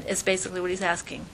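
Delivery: ordinary talk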